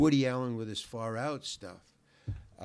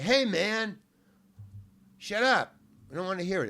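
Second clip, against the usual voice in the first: raised, high heckler yell; heckler's shout; 8 semitones higher, 8 dB louder than usual. Retold or imitated. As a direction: yell to cut off the act. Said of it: "I hear this voice say".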